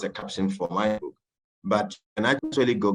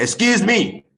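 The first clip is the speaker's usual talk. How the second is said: sudden loud high "Excuse me"; lady in the bank shouting at a stranger, loud and high; shouted; 13 semitones higher, 7 dB louder, slower than usual. Retold or imitated. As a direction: snap it out loud and high, startled. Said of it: "She shouted".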